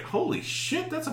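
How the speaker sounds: high, hushed mock astonishment; amazed. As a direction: re-enact stunned amazement. Acts own amazement at recording.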